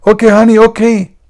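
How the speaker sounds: high, loud mock wife voice; meek wife. Wife obeying husband, voiced.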